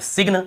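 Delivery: "सिग्न" with the G sounded; wrong pronunciation. Shown as a demonstration. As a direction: say "सिग्न" as sig-nuh, both consonants heard. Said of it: "जी को नहीं पढ़ना है".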